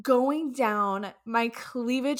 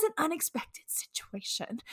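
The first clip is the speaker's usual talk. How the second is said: whisper; 4 semitones higher, 6 dB quieter than usual. Real, not acted.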